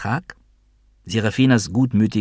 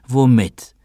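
stern, a touch fearful; loud, higher one-word challenge with some unease; streng, aber ängstlich; 5 semitones higher, 7 dB louder than usual. A narrator's reading. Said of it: "streng, aber ängstlich". (streng, aber ein wenig ängstlich)

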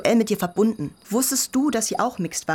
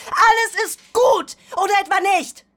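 upset outburst; 12 semitones higher, 6 dB louder than usual. Acted. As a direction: plead it out, near shouting. Teen upset about death talk.

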